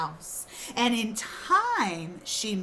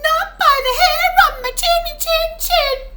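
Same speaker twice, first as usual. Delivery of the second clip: high pig voice; 8 dB louder, faster than usual. Acted. Pig answers wolf.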